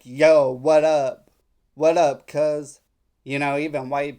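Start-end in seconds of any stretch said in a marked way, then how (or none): 0.0-2.8 louder, slow mock casual greeting; hammed-up slangy yo what up; street slang impression. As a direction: caricature how young people talk. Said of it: "they be like".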